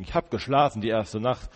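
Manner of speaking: ordinary delivery, nothing marked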